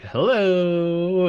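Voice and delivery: Mabel's puppet calling hello as it rolls in. Retold or imitated. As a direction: sing out a cheery puppet hello.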